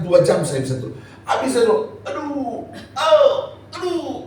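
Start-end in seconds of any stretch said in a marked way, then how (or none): 2.0-4.3 high, drawn-out ouches; imitated pain moans. Speaker re-enacting painful sleepless nights, high moans of pain. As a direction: moan each ouch high, acting out the pain. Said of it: "begitu terus".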